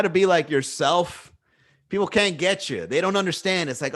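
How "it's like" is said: ordinary talk, nothing marked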